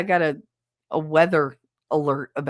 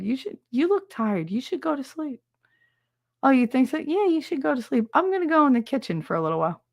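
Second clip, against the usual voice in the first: high, sweet suggestion; raised, put-on caring voice; falsely innocent coaxing; 7 semitones higher than usual. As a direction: nudge him to bed too innocently. Coaxing husband to sleep, slyly.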